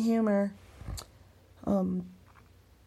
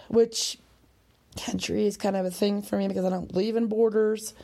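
ordinary talk, nothing marked

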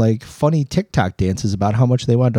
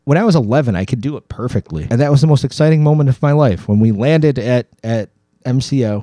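usual speaking voice

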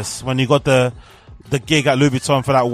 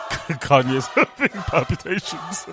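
talking through real laughter; laughing; 3 semitones higher, 5 dB quieter, slower than usual. Real, not acted. Reactor cracks up at his [name] joke, laughing as he talks. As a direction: let the laugh break into the words.

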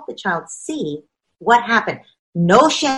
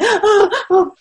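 mock child sob; 11 semitones higher than usual. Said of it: "The kid's like".

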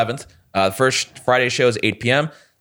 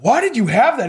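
incredulous question; 13 semitones higher than usual. Retold or imitated. Retold asking the guest next day.

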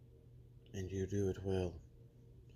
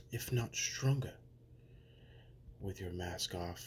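ordinary talk, nothing marked

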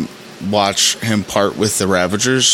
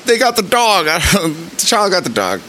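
much higher, giggling through the words; delighted laughing; 8 semitones higher than usual. Real, not acted. Host gushing to podcast listeners, laughing delightedly. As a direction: laugh out the joy of it, slowing on the second line. Gleeful about a favourite scene.

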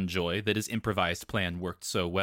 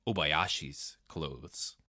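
ordinary delivery, nothing marked

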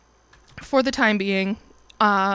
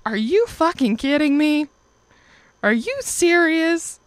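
higher and louder, stunned and furious; mock outraged are you serious; outraged disbelief; 6 semitones higher, 3 dB louder than usual. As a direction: hit the roof at the news. Learning of Facebook breakup.

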